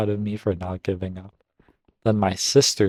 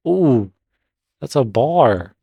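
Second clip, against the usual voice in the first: excited reaction; 4 semitones higher, 6 dB louder, slower than usual. Real, not acted. Reader reacts to a comeback.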